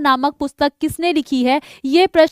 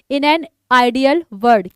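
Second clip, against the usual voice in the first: slow title; much slower than usual. Shown as a demonstration. Teacher reads title slowly.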